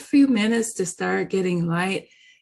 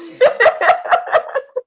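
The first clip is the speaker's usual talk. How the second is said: loud laugh; 7 dB louder than usual. Real, not acted. Laughing at own punchline.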